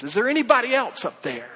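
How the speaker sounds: high, loud mock call upward; retold yell. Tom calls up the cliff for someone else, mock yell. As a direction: call out loudly as the stranded man, hammed-up. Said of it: "he yells up there".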